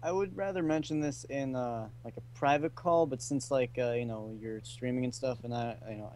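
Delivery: barely audible, far from the mic; really quiet. Real, not acted. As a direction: speak almost too quietly to hear. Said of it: "I can hardly hear you".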